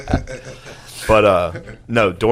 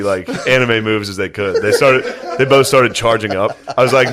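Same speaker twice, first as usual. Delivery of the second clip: normal talking voice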